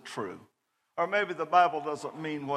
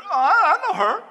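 put-on much higher, loud young voice; high excited reply; 11 dB louder than usual. Young man recognising the name, high eager voice. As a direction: squeak it out eagerly. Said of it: "he said".

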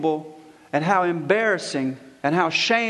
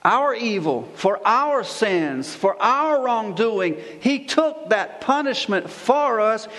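forceful preaching; 4 semitones higher than usual. Real, not acted. Pastor proclaiming Christ as king to his congregation, forceful preaching.

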